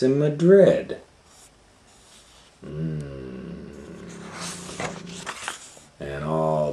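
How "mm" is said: usual speaking voice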